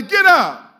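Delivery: loud high shout; shouted call. Shown as a demonstration. Preacher leading the call to the congregation, shouted. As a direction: throw it at the crowd. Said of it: "Somebody say".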